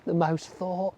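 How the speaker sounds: usual speaking voice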